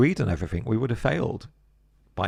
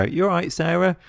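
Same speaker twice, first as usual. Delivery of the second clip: much higher, sing-song "you all right?"; chirpy British greeting; 10 semitones higher, 3 dB louder than usual. Retold or imitated. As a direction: greet a colleague brightly in passing.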